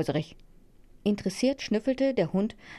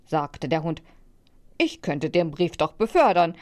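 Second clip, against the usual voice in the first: plain reading voice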